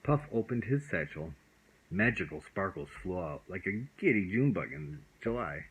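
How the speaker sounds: plain reading voice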